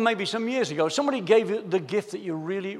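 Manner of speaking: ordinary delivery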